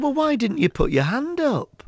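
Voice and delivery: lightly voiced fussing teacher.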